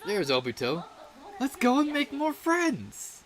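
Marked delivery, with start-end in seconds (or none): 1.4-3.2 much higher, slow, eager call; bright excitement. Acted. Inviting a friend to go make friends, high and eager. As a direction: sing it out with joy. Cheerful invitation after sighting.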